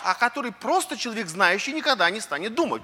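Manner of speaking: ordinary talk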